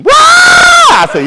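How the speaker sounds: sudden high loud scream. Retold or imitated. Woman startled by the coat, screaming high and loud. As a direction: shriek out in fright. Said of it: "I heard her just scream".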